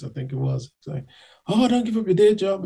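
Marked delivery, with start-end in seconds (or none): 1.5-2.6 high sneering jab, pitched up; mocking taunt. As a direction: tease him like a rude stranger. Mocking guys in bathroom.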